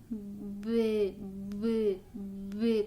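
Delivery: B drawn out until the E shows; B stretched out into "bê". Shown as a demonstration. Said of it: "Se você tentar estender ele um pouquinho".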